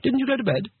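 high-pitched, mildly reproachful question; a shade reproachful. A narrator's reading. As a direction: lightly chide with a high voice. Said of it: "a shade reproachfully".

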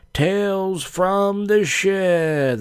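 high, long-drawn title announcement; show title call. Pretend. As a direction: stretch the title out high and slow.

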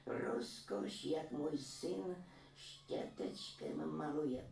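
a granny boasting about her son's painting; proud old woman. A narrator's reading. Old woman voiced in story. (vyšší pomalý hlas pyšné stařenky)

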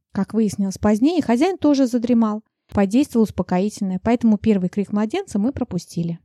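plain reading voice

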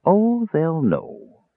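very slow, soft, ominous mage. A narrator's reading. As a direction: draw the words out, softly knowing. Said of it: "said Raistlin softly".

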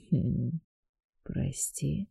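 quiet sinister deity introducing himself; dark god voice. A narrator's reading.

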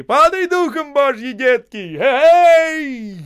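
loud, high encouraging call; rally them, loud and high; cheering shout. A narrator's reading. Osip cheering the men crossing the ice.

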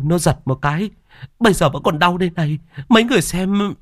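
put-on high-pitched agitated old woman; insistent old woman. A narrator's reading. Mrs Năm insists to villagers she saw ghost, high and agitated. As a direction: plead to be believed, rattled.

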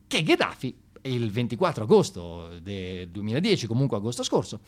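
normal talking voice

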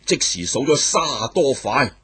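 plain reading voice

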